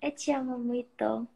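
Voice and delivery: ordinary talk, nothing marked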